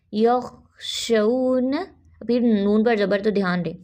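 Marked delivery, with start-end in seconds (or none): 0.1-1.7 यख, शऊन drawn out, zabar on शीन lifted; careful recitation; slow tajweed drill. Shown as a demonstration. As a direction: lift the शऊन gently upward. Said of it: "ख्वा में आवाज़ को चलने दें".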